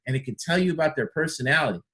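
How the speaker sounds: ordinary talk, nothing marked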